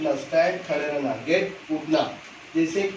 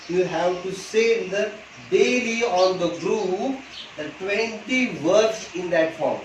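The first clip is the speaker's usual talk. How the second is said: each word of you have to say in the laid out slowly; very slow instruction; much slower than usual. Shown as a demonstration. Teacher to tenth standard students, very slow deliberate instruction. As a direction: spell out the homework rule word by word. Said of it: "teacher dictates homework slowly".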